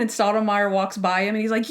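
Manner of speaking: normal talking voice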